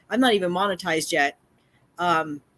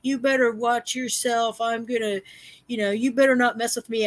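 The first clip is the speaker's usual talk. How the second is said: mock threatening man; 6 semitones higher than usual. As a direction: imitate the neighbor's bravado threats. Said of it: "He says".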